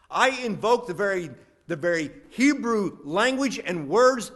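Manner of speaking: loud, high, thundering sermon delivery; booming, emphatic preacher raising the pitch; full-force preaching. Acted. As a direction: proclaim it to the whole church at full voice. Preaches Jesus' words at full force.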